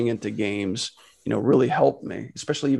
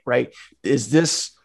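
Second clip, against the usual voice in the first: ordinary delivery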